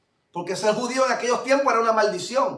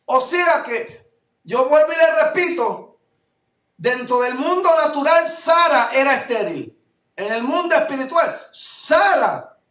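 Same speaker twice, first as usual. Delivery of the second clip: higher and louder, driving each phrase home; preaching at full force; 4 semitones higher, 4 dB louder than usual. Acted. Pastor stirring up the congregation about Sarah, fervent and loud. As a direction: preach it with rising fervor.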